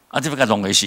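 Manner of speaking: ordinary talk, nothing marked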